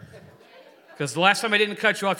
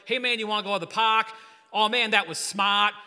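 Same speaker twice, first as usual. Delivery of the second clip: imitating a New York kid, R's dropped; mock New Yorker with no R's; playful New York accent impression; 3 semitones higher than usual. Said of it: "he'd be like".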